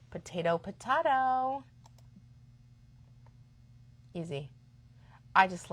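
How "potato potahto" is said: "Potato, potato" as po-TAY-to, po-TAH-to; singsong "potato" with AY, then "potato" with AH; two pronunciations contrasted. Shown as a demonstration. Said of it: "depending on how you say it".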